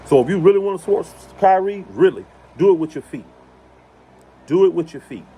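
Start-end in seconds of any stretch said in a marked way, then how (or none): none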